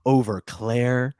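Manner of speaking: very slow, drawn-out disbelief; stretched-out incredulity; incredulous. Acted. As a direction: let each word hang, appalled. Mock disbelief at Frank's choice.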